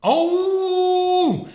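high, loud charging roar; battle roar. A narrator's reading. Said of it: "吼叫着向霸王龙冲了过去".

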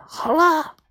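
high-pitched little call. A narrator's reading. Maomao calls from hiding that they're ready, high call. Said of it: "毛毛喊".

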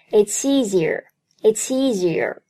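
"it's easier" run together as "it-seasier"; linked pronunciation. Shown as a demonstration. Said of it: "it's和easier连读".

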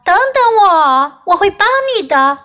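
小狗高声呼喊; Puppy calling out. A narrator's reading. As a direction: call out high to make him wait. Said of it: "波比叫道".

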